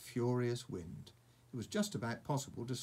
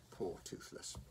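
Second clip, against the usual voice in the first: whispered pity for the dragon; sympathetic whisper; 5 semitones lower, 8 dB quieter than usual. Real, not acted. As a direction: breathe it softly, feeling sorry for him. Sympathetic aside to listening child.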